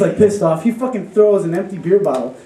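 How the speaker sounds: normal talking voice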